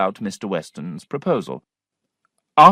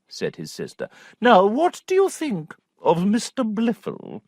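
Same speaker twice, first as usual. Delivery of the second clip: knowing aunt; 8 semitones higher than usual. A narrator's reading.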